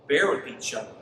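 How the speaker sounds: ordinary delivery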